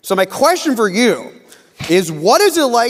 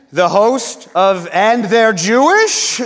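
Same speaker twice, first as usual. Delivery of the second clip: slow, loud, rising show-title announcement; announcer's introduction; 4 semitones higher, 5 dB louder, much slower than usual. Real, not acted. Host introducing a guest's show to the crowd, big announcer delivery. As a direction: build it up for applause, stretching the last word.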